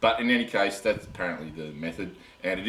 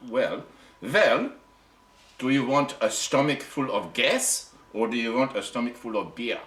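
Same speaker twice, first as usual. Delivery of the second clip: put-on German accent, pitched higher and louder; lecturing a foreigner in a hammed German accent; playful German accent explaining beer; 5 semitones higher, 4 dB louder than usual. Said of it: "I'm allowed to do German accent".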